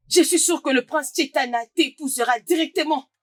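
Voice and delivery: ordinary talk, nothing marked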